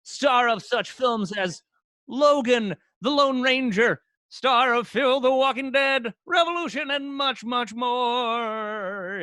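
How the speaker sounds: high, loud spooky ghost wail. Acted. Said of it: "I like that you said that in a ghost voice".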